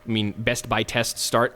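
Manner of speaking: usual speaking voice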